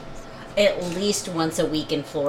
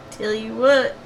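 slow, higher Southern echo through a laugh; a giggly Southern imitation; mock Southern accent, laughing; 5 semitones higher, 5 dB louder, slower than usual. Co-host echoing his Southern catchphrase, laughing Southern accent. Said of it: "your southern accent always cracks me up".

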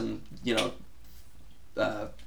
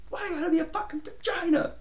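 imitated yell; 4 dB quieter than usual. Retelling a hockey player's outburst over a missed putt, imitated yell. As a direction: yell it high and strangled, held back in volume. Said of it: "he yelled".

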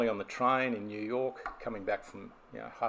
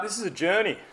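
higher, louder TV promo voice; hammed-up TV announcer; TV show announcer; 10 semitones higher, 8 dB louder than usual. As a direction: sell it like a show intro. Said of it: "This sounds like a T V show".

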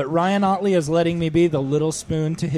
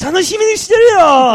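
much higher, loud shouted Japanese; excited anime fan; 13 semitones higher, 8 dB louder than usual. Pretend. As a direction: shout it like an overjoyed anime girl. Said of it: "How excited are you in Japanese?".

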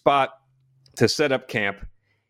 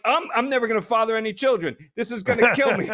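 laughing; 9 semitones higher than usual. Retold or imitated. Freezing in the snow, retold.

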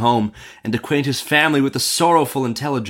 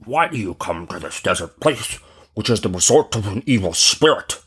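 put-on aged man's voice; old man. Old man warns merchant of evil spirit, aged voice. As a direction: question him as an aged stranger would. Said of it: "said the old man".

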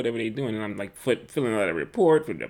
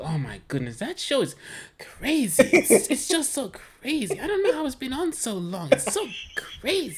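mom's voice, much higher and marvelling; imitating mom; 11 semitones higher than usual. Son mimics his mother raving about The Office, much higher voice. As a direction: lift way up into his mother's amazed tone. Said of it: "She goes".

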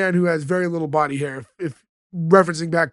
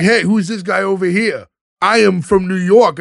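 put-on New York accent, louder at the end; brash mock New Yorker accent; 2 semitones higher, 5 dB louder than usual. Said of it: "if that's not clear by how I'm talking".

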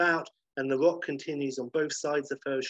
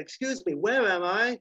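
raised voice; 7 semitones higher than usual. Acted. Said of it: "He's like".

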